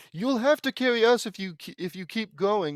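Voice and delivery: high, loud, outraged quoting; high-pitched, accusing recital; indignant requoting. Real, not acted. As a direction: throw the line back as proof of theft. Requotes the stolen line, outraged.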